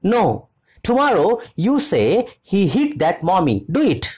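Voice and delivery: put-on little girl demanding her story; child demanding. A narrator's reading. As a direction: insist like a stubborn little girl.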